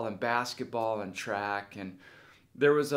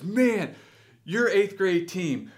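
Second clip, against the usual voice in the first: high, loud, excited reminiscing; enthusiastic coach; 7 semitones higher, 6 dB louder than usual. Retold or imitated. Old coach greeting former player, excited and high. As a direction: gush loudly about a favorite team. Coach's fond recollection, acted out.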